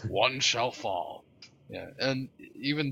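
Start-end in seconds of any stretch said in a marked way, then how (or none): 0.1-1.2 slow, lightly voiced heroic line; mock epic reply. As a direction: finish the showdown quote with a touch of grandeur. Completing the mock epic quote.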